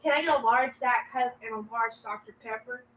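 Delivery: softly retold order in a Southern accent; quiet imitation of a Southern customer. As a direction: put on a Southern accent for the drive-thru. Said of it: "in my southern accent".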